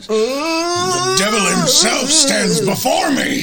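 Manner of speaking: high, slow, shouted proclamation; booming preacher. Acted. Hank faces the walking dead man, a booming preacher's cry. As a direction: thunder it out like a fire-and-brimstone preacher.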